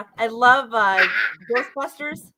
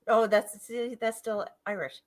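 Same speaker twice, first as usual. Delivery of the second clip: usual speaking voice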